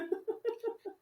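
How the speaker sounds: a soft high giggle; soft amused giggle; light laugh. Real, not acted. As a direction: giggle quietly before moving on. Laughs before changing topic.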